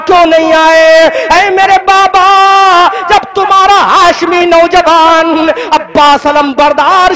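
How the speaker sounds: impassioned majlis lament; preaching at full force. Acted. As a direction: cry it out to the gathering. Preacher voices Zainab's lament.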